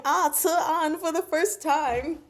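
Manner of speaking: 1 Lanzhou line. Retold or imitated. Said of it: "record Lanzhou saying".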